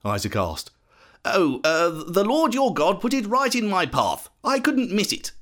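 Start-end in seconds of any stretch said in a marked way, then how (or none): 1.2-5.3 hesitant start, then higher and pitched up; mildly acted fibbing son; lying. A narrator's reading. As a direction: make up an excuse on the spot. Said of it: "Jacob lied again".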